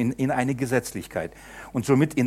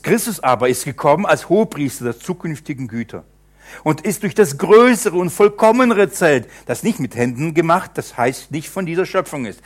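solemn Bible reading, a touch raised; scripture reading; 4 semitones higher, 4 dB louder than usual. Acted. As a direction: read the verse out to the congregation. Reads scripture aloud. (feierliche Schriftlesung)

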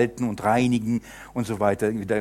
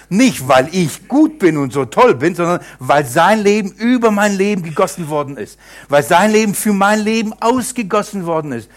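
louder, higher fervent proclamation; full-force preaching; 6 semitones higher, 7 dB louder than usual. Acted. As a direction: proclaim it boldly. (Predigt mit voller Kraft)

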